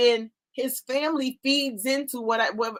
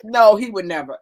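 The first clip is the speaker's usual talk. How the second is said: ordinary delivery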